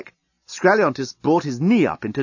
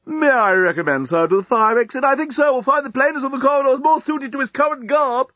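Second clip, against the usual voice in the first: much higher, louder, fussy butler-robot voice; prim robot maitre d'; 14 semitones higher, 3 dB louder than usual. Acted. Robot maitre d' recommending the fire exit, prim and high. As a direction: deliver the snub with stiff politeness.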